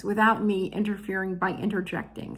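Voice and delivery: ordinary talk, nothing marked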